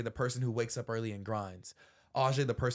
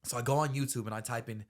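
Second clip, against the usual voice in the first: ordinary talk, nothing marked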